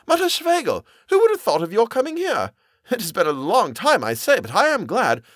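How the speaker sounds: very high, swooping excitement; gushing, surprised character voice; delighted greeting. A narrator's reading. Delighted greeting of unexpected visitor.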